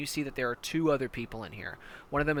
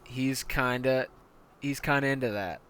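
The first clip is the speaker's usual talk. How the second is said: normal talking voice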